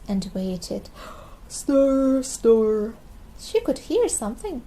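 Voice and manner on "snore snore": loud nasal snoring noise; loud snore. A narrator's reading. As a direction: snore it loudly like a sleeping toddler. George snoring.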